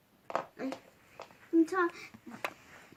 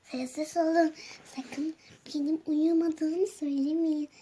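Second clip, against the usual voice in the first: normal talking voice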